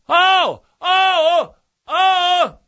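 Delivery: high, drawn-out mock chant; mock oohing. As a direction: imitate the monk's weird singing. Mocking monk singing sounds.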